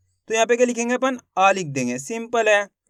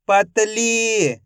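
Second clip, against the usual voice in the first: each syllable stretched out slowly; teacher slowly blends the syllables into a word for kids; slow blended word; much slower than usual. Shown as a demonstration. Teacher to young pupils, blending syllables: slow, drawn out. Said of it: "तो मिलके बन जाएगा".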